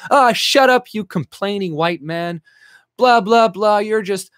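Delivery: high, loud sneering blah blah; hammed-up contemptuous put-down; mocking dismissal. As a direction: brush him off like a jeering scold.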